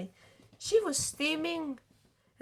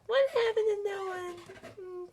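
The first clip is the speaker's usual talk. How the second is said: ordinary delivery, nothing marked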